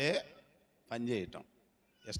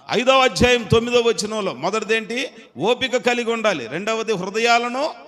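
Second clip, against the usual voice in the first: loud, high preaching call; preaching shout; 9 semitones higher, 14 dB louder than usual. Acted. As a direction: preach it out full force. Preaching at full force. (గట్టిగా ప్రసంగం)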